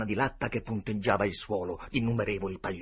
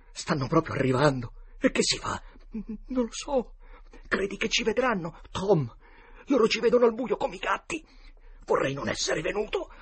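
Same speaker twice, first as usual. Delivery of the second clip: put-on high, loud, frightened boy voice; panicky; 13 semitones higher, 6 dB louder than usual. Boys afraid of being seen in the dark, panicking. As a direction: panic as someone closes in.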